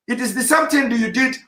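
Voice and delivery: ordinary delivery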